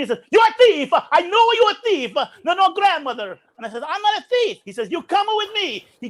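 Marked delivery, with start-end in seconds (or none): 0.3-3.4 high, loud outburst as the guard; mock angry accusation. Guard turning on a boy he accuses, loud mock outrage. 3.9-4.6 high-pitched retold denial; indignant protest. Boy denying the guard's accusation, high indignant protest. 4.9-5.9 high-pitched guard's command; mock stern order. Guard seizing his young suspect, high mock-angry command.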